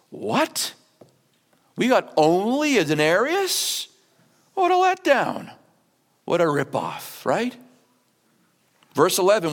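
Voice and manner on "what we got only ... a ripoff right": mock disbelief. Early workers shocked at pay.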